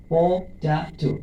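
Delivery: Potato said very slowly, each syllable apart; teacher spells out potato slowly, syllable by syllable. Shown as a demonstration. Teacher to students, potato said slowly by syllables. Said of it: "potato তিনবার".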